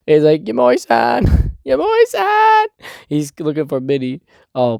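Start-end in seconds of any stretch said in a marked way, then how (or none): 0.4-2.7 slow, much higher call, ending in a shout; drawn-out mock call. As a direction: call for the neighbour across the house, hammed up. Calling out through house.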